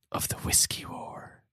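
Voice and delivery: hushed, slow storyteller whisper; dramatic whisper. Acted. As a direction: breathe it like a secret. Dramatic tale reveal.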